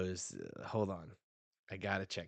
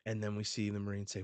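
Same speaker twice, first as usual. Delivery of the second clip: normal talking voice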